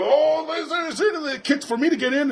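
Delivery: eager cop. Retold or imitated. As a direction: play a cop thrilled at a chance to kick. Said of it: "He's like".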